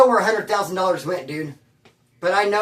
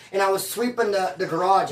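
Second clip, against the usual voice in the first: normal talking voice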